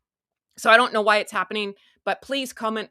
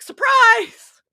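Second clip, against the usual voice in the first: a mocking squeal of "Surprise!"; sarcastic surprise; 14 semitones higher, 10 dB louder than usual. Shown as a demonstration. Vlogger repeating her joke about the surprise visit, mock shriek. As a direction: shriek "Surprise!" very high and loud. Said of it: "I'm gonna be doing that for".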